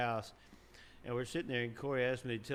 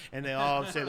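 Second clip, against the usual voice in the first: ordinary delivery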